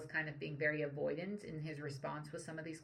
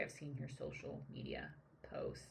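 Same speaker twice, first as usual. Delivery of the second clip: soft unvoiced whisper of a retold jab; whispered quote; 13 semitones lower, 12 dB quieter than usual. Commentator quoting the senator's jab, in a whisper. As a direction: let the quote sink to a whisper.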